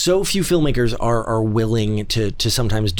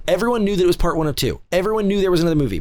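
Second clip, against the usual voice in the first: ordinary talk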